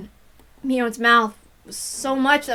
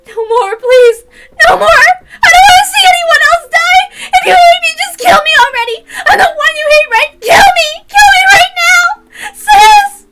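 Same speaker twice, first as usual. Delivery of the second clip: put-on high, loud sobbing screams in a girl's voice; frantic screaming; 17 dB louder than usual. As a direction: scream and sob, begging to die. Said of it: "screaming and rattling the iron bars".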